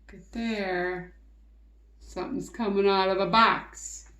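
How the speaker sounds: ordinary delivery, nothing marked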